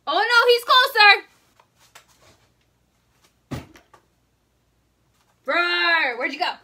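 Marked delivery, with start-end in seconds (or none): 0.1-3.6 high, loud panicked cry; real panic. Real, not acted. Cricket comes nearer to her. 5.5-6.2 loud, drawn-out mock roar; dinosaur roar. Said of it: "Roar like a dinosaur".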